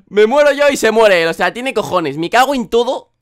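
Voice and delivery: loud, high exasperation; exasperated rant. Real, not acted. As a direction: vent at the bad luck. Loud exasperated rant after death.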